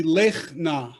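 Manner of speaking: "lech na" with a throaty ch ending "lech"; teacher modelling "lech, na"; two closed-off syllables. Shown as a demonstration. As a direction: close "lech", then a separate "na". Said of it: "end this first syllable".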